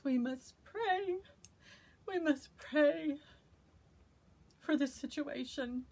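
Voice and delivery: high, slow We must pray; rally them to pray, slowly; urgent plea. Real, not acted. Urgent call to prayer.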